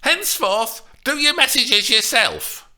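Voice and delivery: high, loud waspish snap; waspish retaliation. A narrator's reading. Nurse snaps back at Juliet, waspish. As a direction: hit back, stung. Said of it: "she retaliated waspishly".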